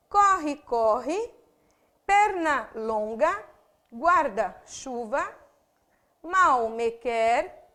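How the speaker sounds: slow, each half of every compound stressed separately, as in "Guarda roupa"; two stresses per compound. Shown as a demonstration. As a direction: pull "passa tempo" apart, both words stressed. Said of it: "quando eu pronuncio essas palavras".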